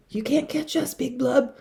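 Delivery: high teasing call to the fish; taunting call. A narrator's reading. Last sister taunting Big Blub, high teasing call. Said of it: "called out".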